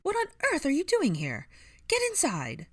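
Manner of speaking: put-on high, loud scandalized old woman; shrill, flustered voice; shrill scolding. A narrator's reading. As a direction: scold them shrilly and hurry them in. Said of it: "a shrill voice called".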